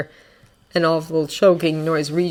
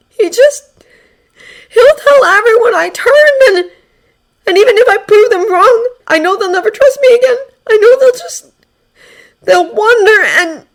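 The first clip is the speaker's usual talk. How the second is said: high, loud, sobbing and stammering; shaking with sobs; 10 dB louder than usual. Acted. Izuku retelling how he was abandoned, sobbing. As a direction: sob and stumble over every word. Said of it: "angry and helpless".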